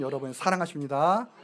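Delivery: normal talking voice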